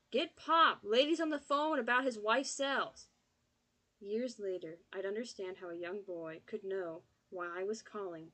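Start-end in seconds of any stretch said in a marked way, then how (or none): 0.1-3.1 loud, high yell away from phone. Acted. Boy calling away from the phone, loud high yell. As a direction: shout it across the house.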